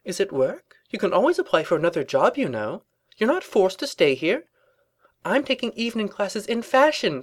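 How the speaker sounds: high-voiced, lively well-meaning advice; concerned friend. A narrator's reading. Rarity advises Twilight on her social life, high and concerned. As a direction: fuss helpfully over a friend.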